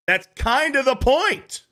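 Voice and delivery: high mocking voice of the woman; sing-song mock of her claim.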